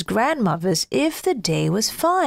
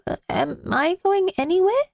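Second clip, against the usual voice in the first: put-on higher, puzzled child; little boy voice; 9 semitones higher than usual. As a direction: ask as an unsure boy. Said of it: "asked the child".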